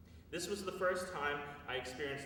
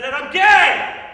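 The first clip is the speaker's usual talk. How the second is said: climactic shout; 26 dB louder, much slower than usual. Retold or imitated. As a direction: blurt it out as loud as you can, slow and stretched. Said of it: "in climactic fashion".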